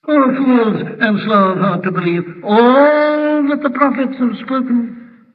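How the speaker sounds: emphatic scripture cry, stretching "all"; stress on ALL. Shown as a demonstration. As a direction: let "all" soar and linger. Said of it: "the emphasis there is on the word all".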